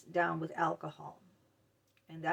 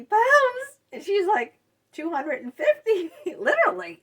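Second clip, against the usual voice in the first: high laughing disbelief, much louder; 11 semitones higher, 10 dB louder than usual. Real, not acted. Laughing about the huge bully and her tiny self. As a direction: laugh through the numbers, pitched way up. Laughing over size contrast.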